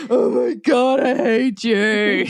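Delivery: a hint of grumpy teen, the end slow; mock groaning protest. Son dragging himself up for dad, mock grumpy groan. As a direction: groan it out, half-joking.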